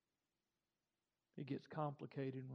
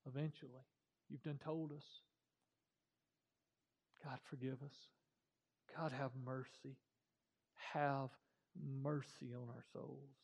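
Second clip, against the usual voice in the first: prayer; 3 semitones lower than usual. Acted. Closing prayer.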